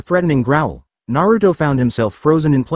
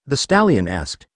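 ordinary delivery, nothing marked

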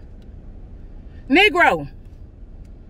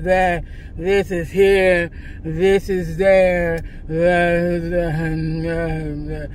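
slow, lower, dull mock of the clerk; dry clerk imitation; 7 semitones lower, much slower than usual. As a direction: drone it like the bored storage guy. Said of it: "He's so dry".

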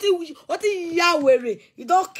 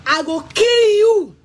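higher, louder, slow shouted threat; furious threat; 7 semitones higher, 6 dB louder, slower than usual. Real, not acted. Threatening Victor with a gun, shouting slowly. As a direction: roar each word out slowly at him.

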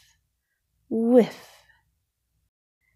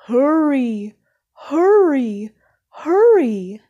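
each hurry higher and louder, pressing on; urging, building; 5 semitones higher, 6 dB louder than usual. Acted. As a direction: push them to run faster and faster. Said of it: "urged Bear".

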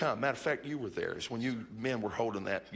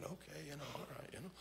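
much softer, half to himself; quiet thought; 3 semitones higher, 16 dB quieter, faster than usual. Retold or imitated. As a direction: say it under your breath, unsure. Inner reply to Barnes.

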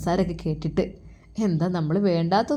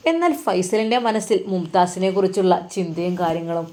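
plain reading voice

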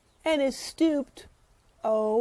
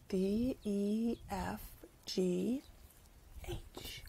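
each letter softer, ending unvoiced; fading letters; 2 semitones lower, 11 dB quieter, much slower than usual. Shown as a demonstration. Teacher showing kids the letters fading out. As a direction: let the letters fade away. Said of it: "Can you do this a different way?".

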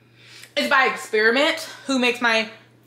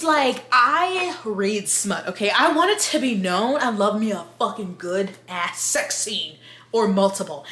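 normal talking voice

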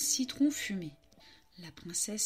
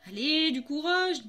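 plus aigu et plus fort; encouragement; 5 semitones higher, 6 dB louder than usual. Acted. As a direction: pousser la harpe à oser. Le chat encourage. (encouragement aigu et fort)

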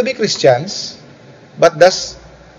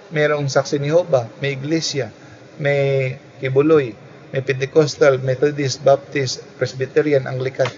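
usual speaking voice

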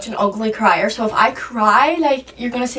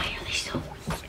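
whispered aside; 16 dB quieter than usual. Real, not acted. Annoyed aside about flies.

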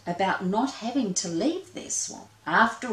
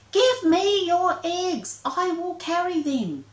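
high slow urgent call; 11 semitones higher, 4 dB louder than usual. Acted. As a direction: coax the bird down. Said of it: "called ... as loudly as she could".

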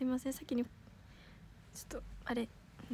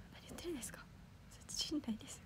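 whisper; 12 dB quieter, faster than usual. Real, not acted. Bashful denial to chat compliment.

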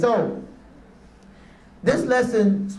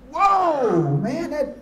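pitched up high in amazement; higher, amazed whoa; stunned whoa; 8 semitones higher, much slower than usual. Retold or imitated. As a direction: caught completely off guard. Stunned by daughter's words.

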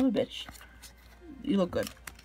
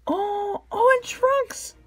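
delighted squeal; 9 semitones higher, slower than usual. Real, not acted. Another favorite card appears.

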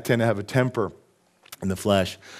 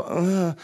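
reluctant groan; 6 semitones higher than usual. Retold or imitated. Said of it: "you kinda go".

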